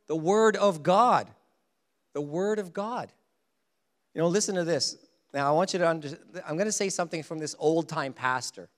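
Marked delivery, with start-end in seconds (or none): none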